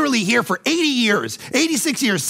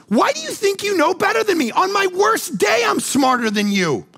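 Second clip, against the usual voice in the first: God rebuking; 12 semitones higher than usual. Retold or imitated. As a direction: scold like a frustrated father. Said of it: "And God goes".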